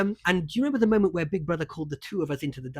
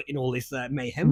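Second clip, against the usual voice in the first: normal talking voice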